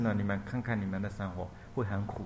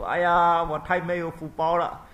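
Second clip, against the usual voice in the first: mock wailing; 6 semitones higher, 7 dB louder than usual. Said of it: "变那个他大哭".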